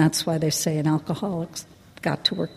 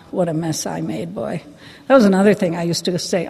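usual speaking voice

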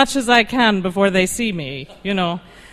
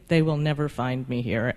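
smug low murmur; 8 semitones lower, 6 dB quieter than usual. Retold or imitated. Drunken inner thought.

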